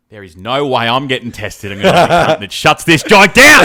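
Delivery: loud joking defiance to the boss; defiant mock rant. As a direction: shout it like a rowdy rebel. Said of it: "But I can tell you one thing".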